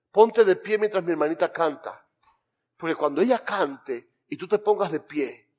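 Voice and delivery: ordinary talk, nothing marked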